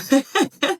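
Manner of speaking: short, high-pitched laugh. Real, not acted. Vlogger laughing about living costs, high giggle. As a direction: let out a quick high giggle. Laughs at own money complaint.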